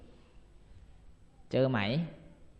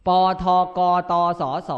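each letter ป ท ก ต ส ส called out louder, one by one; a louder rote chant of the letters; drilled letter list; 3 semitones higher, 8 dB louder than usual. Shown as a demonstration. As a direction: recite ป ท ก ต ส ส like a classroom drill. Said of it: "ให้จำ ปาท่องโกติดสารสูง".

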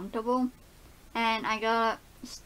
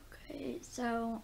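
whispering; 7 dB quieter, slower than usual. Real, not acted. Just woke up, filming quietly.

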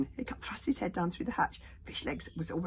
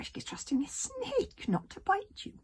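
high slow whispery dragon; little dragon voice; 5 dB louder, slower than usual. Acted. Toothless voice.

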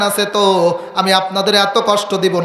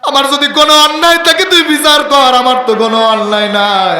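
high, loud and fast, a preacher voicing a wronged mother; pleading mother; 5 semitones higher, 4 dB louder, faster than usual. Mother pleads with the son she raised, loud high wail. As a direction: cry out the mother's hurt to her son.

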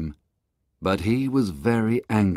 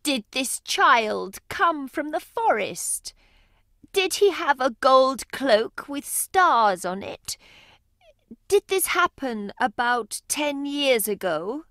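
much higher, urgent questioning woman's voice; anxious mother. Acted. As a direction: press him with questions, hoping.

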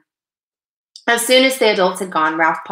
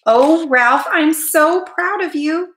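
high-pitched, slowing motherly praise; proud mother, drawn out and high; relieved pride; 10 semitones higher, 4 dB louder, slower than usual. A narrator's reading. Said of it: "said his mother with a sigh of relief".

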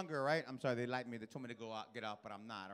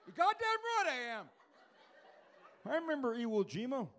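veteran father; 5 semitones higher than usual. Retold or imitated. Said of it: "Can my father sit in the front?".

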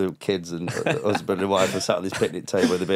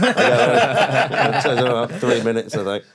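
laughing excuse; 4 semitones higher, 4 dB louder, slower than usual. Retold or imitated. Retold onstage excuse.

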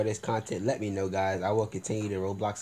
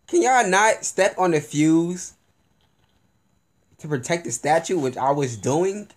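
exasperated; 7 semitones higher, 6 dB louder than usual. Real, not acted. Scolding teammates.

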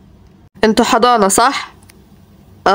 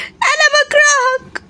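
put-on high, slow sulking kid; high, slow pouty child; sulky child; 12 semitones higher, slower than usual. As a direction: pout it like a hurt kid.

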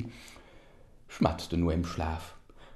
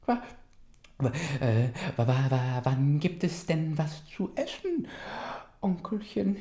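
stammering cub voice; 8 semitones higher than usual. Acted.

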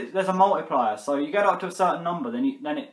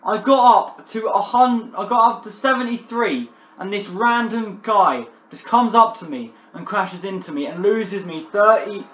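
angry rant; 5 semitones higher, 6 dB louder than usual. Real, not acted. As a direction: rant it with rising fury. Ranting about lost points.

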